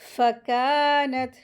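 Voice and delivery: फ़कानत with a long held kaa; stretched madd. Shown as a demonstration. Teacher models two-beat madd, फ़कानत with drawn-out kaa. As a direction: draw out the aa of फ़कानत. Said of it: "दो हरकत खींच के पढ़ेंगे".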